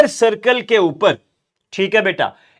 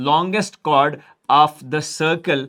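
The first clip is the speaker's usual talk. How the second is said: drawn out word by word; very slow dictation; 3 semitones lower, much slower than usual. Shown as a demonstration. Teacher dictating the diameter definition to students, very slowly. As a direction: spell it out slowly for note-taking.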